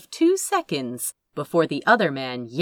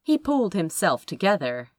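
plain reading voice

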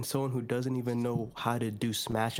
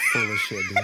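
laughing shout; 7 dB louder than usual. Real, not acted. Laughing accusation.

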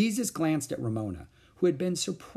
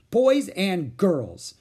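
very slow, louder call; raised teacher voice; 6 semitones higher, 7 dB louder, much slower than usual. A narrator's reading. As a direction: call the class to order. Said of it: "Miss Robbins raised her voice".

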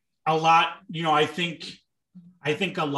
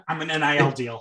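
normal talking voice

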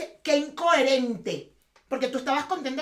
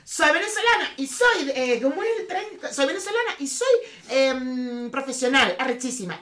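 rushed casting self-intro; 2 semitones higher, 5 dB louder than usual. Pretend. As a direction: stumble through a timed audition intro. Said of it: "en veinte segundos decir".

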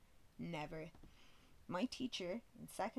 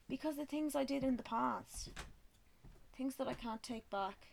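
mock tragic; 6 semitones higher than usual. Mock-dramatic confession to coworker.

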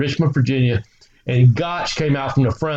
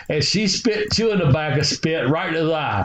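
usual speaking voice

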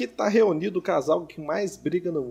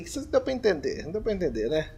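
ordinary talk, nothing marked

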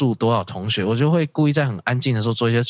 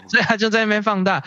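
laughing; 10 semitones higher, faster than usual. Real, not acted. Laughing while retelling.

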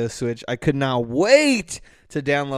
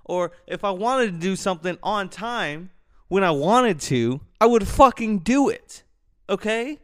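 raised, exasperated rant; high-pitched angry comeback; angry retort; 8 semitones higher than usual. Retold or imitated. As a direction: fire back, fed up and heated. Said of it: "I'm like".

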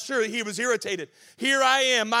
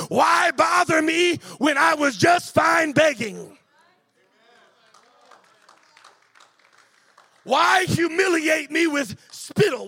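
irritated blind man; 3 semitones higher, 5 dB louder than usual. Acted. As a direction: cry out at Jesus in rising frustration. Blind man protests, rising to shouts.

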